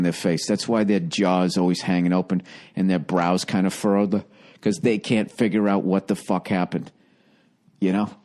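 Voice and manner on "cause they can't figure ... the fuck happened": ordinary talk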